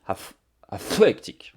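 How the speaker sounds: ATHLETIC in a laboured English accent, blowing hard on the th; clumsy, drawn-out English accent on athletic; overdone English accent. Real, not acted. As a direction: slowly force out the English th in athletic. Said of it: "avec mon superbe accent anglais, mon pauvre micro".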